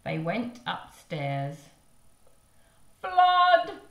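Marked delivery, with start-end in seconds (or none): 3.0-3.8 loud alarmed yell; shout. Acted. Father shouting about the flood upstairs, loud yell. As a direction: bellow a warning. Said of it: "shouted Mister Blair".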